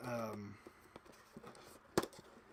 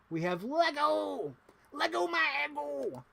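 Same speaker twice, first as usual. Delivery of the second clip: much higher, slow cartoon voice; silly character voice; 12 semitones higher than usual. Shows off the Lego to viewers, goofy high voice. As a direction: ham up the announcement in a goofy voice.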